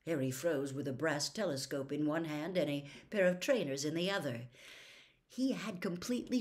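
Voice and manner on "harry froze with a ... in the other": ordinary delivery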